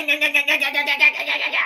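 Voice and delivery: high, rapid-fire string of identical yeahs; mock yammering. Host mimicking an esoteric Twitter account, mock yammering.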